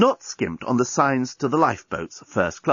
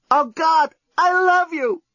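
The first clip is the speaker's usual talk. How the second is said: much higher, louder breathless blurt; Lucy's outburst; 4 dB louder, slower than usual. Acted. Lucy to the journalist facing death, a sudden high passionate cry. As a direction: burst out with it, overwhelmed.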